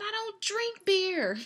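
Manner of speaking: slow, high, loud protest; emphatic retort. Retold or imitated. Runner answers the cheering lady, slow loud high retort. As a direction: shout it back, slow and firm. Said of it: "I said".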